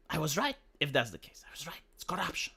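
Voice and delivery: hushed whisper to self. Real, not acted. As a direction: breathe it out, barely voiced. Quiet vindication aside.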